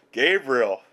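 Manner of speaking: laughing the name out in joy; delighted laugh. Real, not acted. Preacher laughing happily as Gabriel appears. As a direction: laugh through the word.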